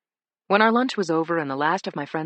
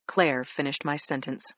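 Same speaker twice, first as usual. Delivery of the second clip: usual speaking voice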